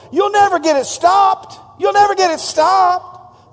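mocking shout. The scoffer yells from the bank as the boat leaves. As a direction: yell it at the boat from the riverbank. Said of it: "chased him down the bank going".

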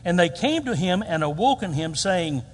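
ordinary talk

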